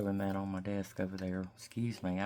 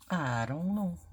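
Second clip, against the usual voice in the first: pitched up, playful dithering; higher undecided; 8 semitones higher, 4 dB louder than usual. Real, not acted. Crafter weighing two stickers, higher playful dither. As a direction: lift it up high, sing-songy indecision.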